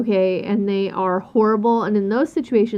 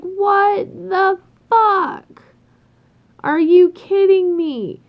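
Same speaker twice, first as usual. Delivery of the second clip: high, loud, dragged-out disbelief; outraged disbelief; 8 semitones higher, 5 dB louder, much slower than usual. Retold or imitated. Stylist seeing the ruined hairdo, loud disbelief. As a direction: stretch out a stunned what-the-hell. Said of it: "And I'm just like".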